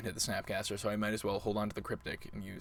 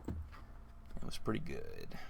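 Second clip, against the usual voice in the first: slow, lower hushed voice; slow whisper; 8 semitones lower, 7 dB quieter, much slower than usual. Real, not acted. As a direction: murmur it, satisfied. Savoring the win.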